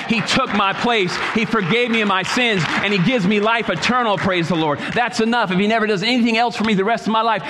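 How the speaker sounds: stirred preacher's testimony; preaching. Acted. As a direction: testify it out to the church with conviction. Pastor preaching his testimony.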